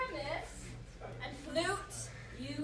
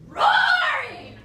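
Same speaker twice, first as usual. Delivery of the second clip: roaring; 12 semitones higher, 16 dB louder than usual. Acted. Roaring the lion's part.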